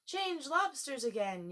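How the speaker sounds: mild hammed-up yell; top-of-voice yell. A narrator's reading. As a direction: yell it across the sea. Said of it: "yelled the Gryphon at the top of its voice".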